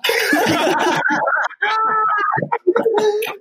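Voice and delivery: high, squealing laugh; long high-pitched burst of laughter; helpless laughter. Real, not acted. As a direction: crack up at your own story. Cracks up at own anecdote.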